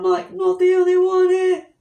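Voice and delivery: high, loud squeal of joy; so happy. Retold or imitated. Overjoyed to find another British person, happy squeal. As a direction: squeal it, overjoyed and nearly crying. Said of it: "so freaking happy".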